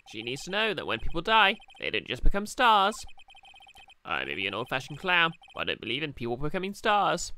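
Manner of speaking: loud clown voice driving home a hard truth; old-fashioned clown, serious. Acted. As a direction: play an earnest old showman standing firm. Voicing the clown character.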